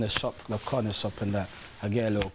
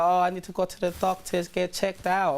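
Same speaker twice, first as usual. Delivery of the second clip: mock baby mum voice; 6 semitones higher than usual.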